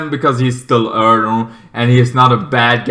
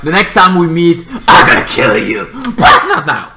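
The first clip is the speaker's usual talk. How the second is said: creepy threat, rising higher; creepy villain; 4 semitones higher, 3 dB louder than usual. Retold or imitated. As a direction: menace him, then lift on the last bit. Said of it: "That's a creepy guy".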